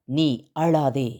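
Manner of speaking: slow, low, gentle voice of God; tender divine command. Acted. God telling the weeping listener not to cry, slow and tender. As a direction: lay the words down softly and slowly, like comfort. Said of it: "அவர் கொடுத்தனுப்பிய வார்த்தை".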